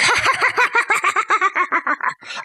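put-on laughing fit; imitated laughing. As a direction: act out his rattling run of ha-ha-ha. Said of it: "he started laughing".

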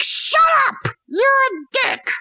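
sudden high yelled outburst; mock shout. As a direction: snap at him in a high cry. Hamlet snaps at Polonius.

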